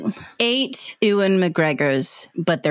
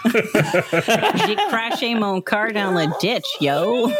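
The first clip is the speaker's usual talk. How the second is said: slow Franglais in a hammed-up French accent; half French half slang, in a mock French accent; 3 semitones higher, slower than usual. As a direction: play up a silly French accent. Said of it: "French accent".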